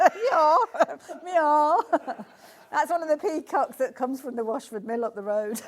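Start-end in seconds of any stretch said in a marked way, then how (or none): 0.1-2.2 high, loud meow through laughter; playful laughing mock meow. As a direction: meow at the peacock, giggling. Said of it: "It's better as a peacock".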